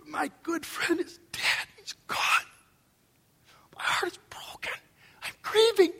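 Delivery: breaking into an airy whisper; grief-stricken. Acted. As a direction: mourn a friend in a hushed, high, thin voice. Voicing grieving human Jesus.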